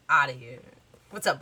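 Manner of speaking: ordinary talk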